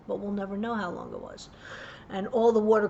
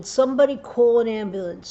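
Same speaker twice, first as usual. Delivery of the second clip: desperate retold yell, louder; screaming for help; 3 semitones higher, 8 dB louder than usual. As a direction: shout it across the pool. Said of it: "And I'm screaming".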